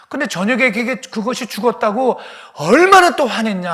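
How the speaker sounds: loud, high rebuking shout that keeps building; God's angry rebuke. Acted. God scolding Jonah over the dead plant, loud rebuking shout.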